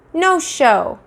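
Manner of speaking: put-on high stubborn little-kid voice; toddler voice. Fudge refusing to open mouth, high stubborn toddler voice.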